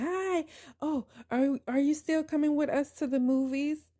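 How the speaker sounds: high, sugary put-on greeting voice; mock sweet sister-in-law. Sister-in-law greets my husband, imitated in a high sugary voice. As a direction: imitate her fake-sweet hello, pitched high.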